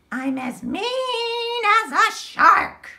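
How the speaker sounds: mock mean shark. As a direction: ham up a nasty shark. Reading mean line.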